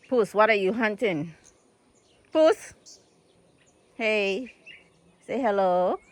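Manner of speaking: calling out loud and high to the cat. Real, not acted. Calling to the cat in the yard, loud and high. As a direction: call the cat sharply from across the yard.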